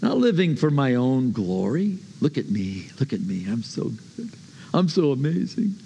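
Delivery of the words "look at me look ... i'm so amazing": low, soft, smug boasting; mock self-admiration. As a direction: murmur it low, pleased with yourself. Mocking a self-glorifying boaster.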